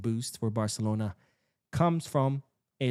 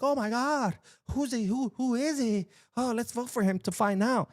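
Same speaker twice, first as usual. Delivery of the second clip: much higher, excited mock fan voice; gullible voters; 12 semitones higher than usual. Voters gushing over a mystery signing, mock excited and high. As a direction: play a breathless, clueless voter who can't wait to find out. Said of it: "People are like".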